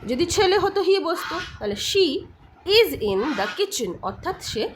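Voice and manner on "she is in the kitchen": very slow, separated words; deliberate example reading; slow model answer. Shown as a demonstration. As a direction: spell the phrase out word by word. Teacher models dialogue answer.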